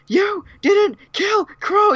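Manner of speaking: high, drawn-out mock scream; imagined screaming Salem. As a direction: screech it slowly as an outraged villain, hammed-up. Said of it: "like, screaming".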